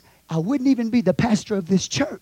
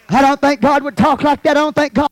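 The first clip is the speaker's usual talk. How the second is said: higher, louder mimicked complaint; mocking objector; 4 semitones higher, 4 dB louder than usual. Preacher mimics a churchgoer's objection, mocking. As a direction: parrot the objection loudly.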